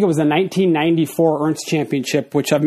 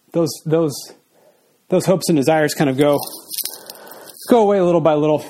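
winded after a workout; a bit out of breath. Real, not acted. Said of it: "Forgive me if I'm a little out of breath".

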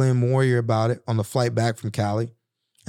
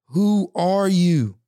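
slow, higher, pained question to the mirror; asking his reflection; 6 semitones higher, much slower than usual. Acted. Man asks his mirror reflection, slow and searching. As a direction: draw it out, searching your own face.

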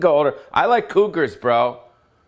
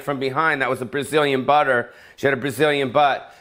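ordinary talk

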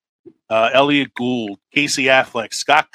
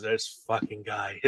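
muttered movie quote; 3 semitones lower, 13 dB quieter, slower than usual. Retold or imitated.

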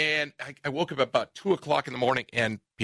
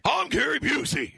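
high, slow shouted celebrity impression; Gary Busey; 11 semitones higher than usual. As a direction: shout it as a wild impersonation. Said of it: "I gotta hear this".

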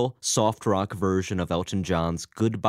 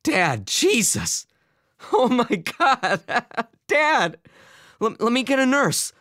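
loud, very high panicked outburst; panicked gasp; 5 dB louder, slower than usual. A narrator's reading. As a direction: gasp and cry out in alarm. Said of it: "Albert gasped".

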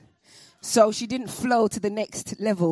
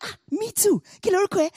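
much higher, bright eager greeting; imitating excited girl; 12 semitones higher, 4 dB louder, faster than usual. The new girl greeting Ayodele, much higher and eager. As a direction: greet her like a long-lost sister. Friendly girl speaks Yoruba.

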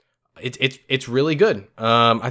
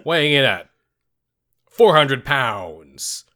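booming fighting game announcer. Pretend. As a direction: stretch each weight out like a prizefight intro. Boxing-match introduction of two hosts.

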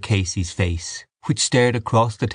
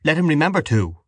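higher-pitched, understated cry; heated retort, raised in pitch; 7 semitones higher than usual. A narrator's reading. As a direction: call it across the table. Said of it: "cried Mister Casey to her".

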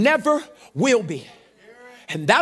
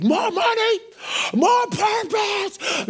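high, drawn-out mock crowd shout; excited shouting; 10 semitones higher, much slower than usual. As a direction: chant it high, stretching each word. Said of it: "are shouting after last week".

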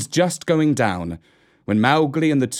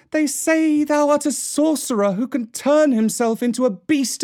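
anguished cry; 9 semitones higher than usual. A narrator's reading. Mesua pleads with her son to go away, anguished cry. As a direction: cry out high, urgent and pleading. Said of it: "ran across to the herd and cried".

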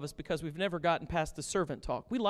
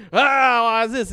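loud, high indignant mock question; mocking Pharisees; 6 semitones higher, 15 dB louder than usual. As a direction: play the baffled Pharisees. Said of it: "they said".

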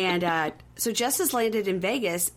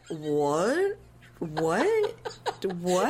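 repeated rising, drawn-out questions; mock bewilderment; much slower than usual. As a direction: imitate his stunned, dumb disbelief. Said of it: "And he's like".

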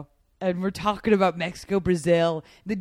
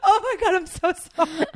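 high-pitched, flustered apology; retold mortified apology; 10 semitones higher, 4 dB louder than usual. Passenger apologizing to the driver from Ghana, high and mortified. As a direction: reenact cringing at your own blunder.

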